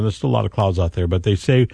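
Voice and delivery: ordinary talk, nothing marked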